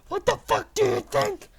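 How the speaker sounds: high, loud furious snap; angry retort. A narrator's reading. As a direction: spit it back at her in a rage. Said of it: "I asked angrily".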